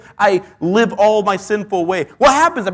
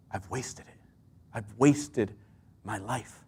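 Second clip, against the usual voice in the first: regretful hush; 8 semitones lower, 13 dB quieter, slower than usual. Retold or imitated. People regret their wasted life.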